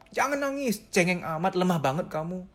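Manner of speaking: mock scolding, opening high-pitched. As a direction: tell off a crybaby in a put-on voice. Mimics partner forbidding crying.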